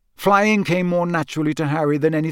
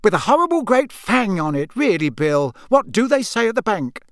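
high, scolding mum voice; a mother fretting over her son's earring; fussing mother; 7 semitones higher, 4 dB louder than usual. Acted. Mrs Weasley voiced in story.

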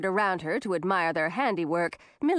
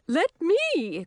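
high, excited fairy voice; high-pitched eager exclamation; eager cry; 8 semitones higher than usual. A narrator's reading. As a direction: butt in, begging for a go. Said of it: "cried another fairy".

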